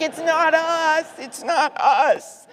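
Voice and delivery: high, loud, drawn-out plea of innocence; mock protest. Back-row senators pleading innocence to a glaring colleague, high mock protest. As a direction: protest your innocence loudly, stretching each word.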